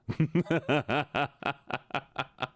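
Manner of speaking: long staccato chuckle, trailing away; real laugh. Real, not acted. Streamer after teasing viewers through the screen, laughing. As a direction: laugh in quick little pulses.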